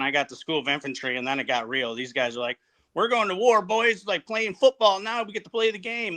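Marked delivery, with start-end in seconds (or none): 2.9-6.2 eager, raised, pumped-up voice; hyped young recruits cheering; excited. Retold or imitated. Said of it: "They're all excited".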